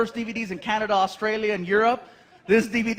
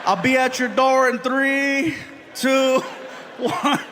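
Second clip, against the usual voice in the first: a slow, drawn-out countdown, higher; 4 semitones higher, slower than usual. Retold or imitated. Dad counting down outside Frankie's door, slow. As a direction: count it down like a dramatic warning.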